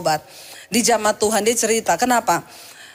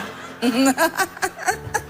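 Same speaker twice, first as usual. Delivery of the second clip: light breathy chuckle at own joke; a short, amused laugh, pitched up; real laugh between lines; 6 semitones higher, 4 dB quieter than usual. Real, not acted.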